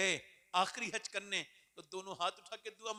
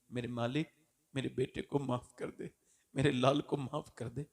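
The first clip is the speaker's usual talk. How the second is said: tender pleading; 8 semitones lower than usual. Acted. Mother begging God to forgive her son, low and tender. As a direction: plead gently for your child.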